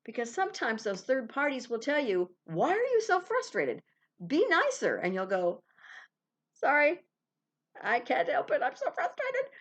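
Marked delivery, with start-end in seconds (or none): 2.4-4.9 mock scolding, high and loud; a mock scold from a friend. Third party scolding you, acted. 6.5-9.5 high, whiny mock apology; a pleading, petulant mock sorry.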